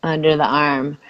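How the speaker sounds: soothing tapping script; guided tapping. Acted. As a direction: lead the phrase gently for her to repeat.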